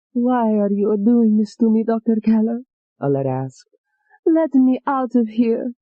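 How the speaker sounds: a lightly voiced frightened young woman; pleading Alette.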